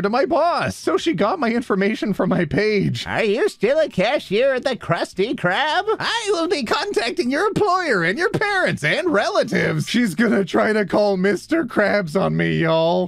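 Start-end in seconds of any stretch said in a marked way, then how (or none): 3.1-9.9 high-pitched indignant old-lady impression; mock a threatening online busybody, voice raised in pitch; angry old lady. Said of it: "this old lady on Facebook got angry at me".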